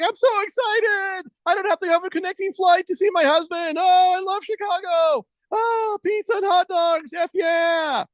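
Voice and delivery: very high, shrieking fangirl squeal; hammed-up high-pitched shriek of joy; mock squealing excitement. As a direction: squeal it like a giddy fan who can't contain herself. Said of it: "And then she's like".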